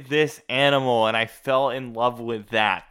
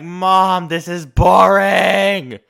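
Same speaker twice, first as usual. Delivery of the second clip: put-on loud, whiny teen protest; retold loud complaint to mom about boring shows; complaining to mom; 2 semitones higher, 6 dB louder than usual.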